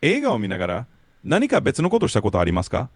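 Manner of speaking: fast fluent Japanese question; native Japanese question. Shown as a demonstration. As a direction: clear native Japanese prompt. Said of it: "Can you repeat that, please?".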